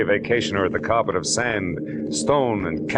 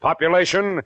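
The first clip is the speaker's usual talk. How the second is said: higher, punchy announcer's hook; dramatic teaser announcement; 8 semitones higher than usual. Acted. Narrator teases the listeners with a dramatic hook. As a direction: sell the mystery to the radio audience. Radio show teaser opening.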